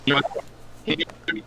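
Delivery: choppy cutting-out audio; broken video call connection; corrupted glitchy audio. Real, not acted. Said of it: "His audio is cutting out very badly".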